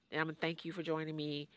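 fast run-through of the plan; quick rundown. Real, not acted. Host explaining the show format to new listeners, fast. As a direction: rattle it off quickly.

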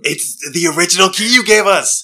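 high, loud, excited reveal; excited explaining. Acted. Excitedly explains key in character.

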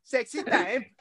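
put-on high, loud Borat accent. Guest shows hosts his Borat accent, hammed up. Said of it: "you kept doing the Borat accent".

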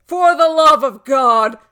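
full-voiced answering cry; shouted echo. A narrator's reading. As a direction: bellow his words back at him.

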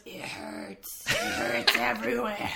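feeble hushed mock kid voice, breaking into a laugh; imitated dying kid. Podcaster acting out the dying kid in hospital, feebly, laughing. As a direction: imitate a sick child, starting almost voiceless. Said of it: "And the kid goes".

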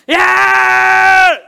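high, loud, full-force shout. Real, not acted. Preacher exclaiming about God's wrath, loud high shout. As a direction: roar it out in preaching fervor.

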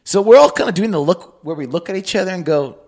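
ordinary talk, nothing marked

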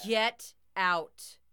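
loud howled command. A narrator's reading. Both toys chase off the intruder, loud howl. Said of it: "my grandfathers howled together".

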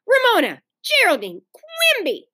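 high, separated full-name call; rising warning to a sister; exasperated scolding. A narrator's reading.

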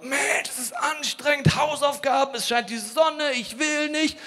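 high, slow whining schoolkid voice; whiny child.

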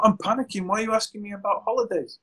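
the panicking client baffled by the question; mock panicking client. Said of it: "They're like".

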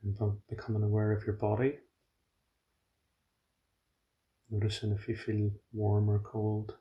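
soft, even meditation voice with long pauses; hushed, unhurried settling instructions; guided meditation. Real, not acted. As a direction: guide them gently into body awareness, quietly.

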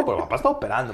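usual speaking voice

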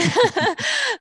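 bright burst of laughter; high laugh. Real, not acted. Laughs at tricky question.

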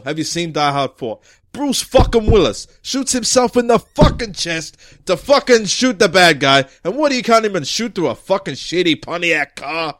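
heavy, emphatic chief's tirade; ranting police chief. Acted. Chief ranting at detective over a missed shot, exasperated. Chief rants about Die Hard.

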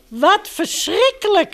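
high-pitched storybook exclamation; a bear calling out, lightly acted; called out. A narrator's reading. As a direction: cry out in mild alarm. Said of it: "riep Boris".

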